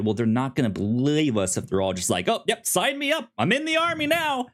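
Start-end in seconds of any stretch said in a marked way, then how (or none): 2.3-4.5 high, fast, gung-ho recruit; mock eagerness. Host voices fake turncoats joining up, mock eagerness. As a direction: overdo the instant enthusiasm.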